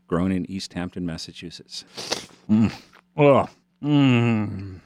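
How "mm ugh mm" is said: savoring mm and a loud ugh; Monty eating a peach. Retold or imitated. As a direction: relish each bite with loud groans. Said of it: "This is my impression of Monty eating a tree-ripe, flavorful peach".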